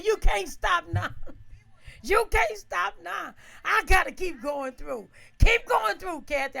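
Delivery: high, loud pep talk to herself; frantic shouted encouragement; self-urging shout. Retold or imitated. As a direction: yell yourself onward through the water. Urging herself through flood.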